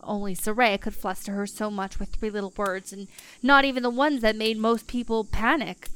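plain reading voice

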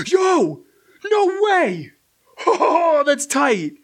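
high, bursting cries of delight; real excitement. Real, not acted. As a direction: blurt it out, thrilled by the find. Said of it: "Sorry, I got excited".

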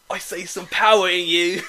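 hammed-up, muddled British impression; bad British accent. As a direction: badly fake a British accent that slips into Australian. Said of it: "I don't know if you're going British or fucking crocodile".

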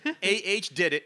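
"A H" read as two separate letter names, loud; spelled-out letters. Shown as a demonstration. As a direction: read "A H" letter by letter in mock disbelief. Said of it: "He writes the dialogue".

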